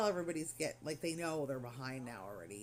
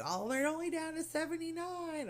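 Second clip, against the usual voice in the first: imitating patient; 11 semitones higher than usual. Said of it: "I keep hearing him say".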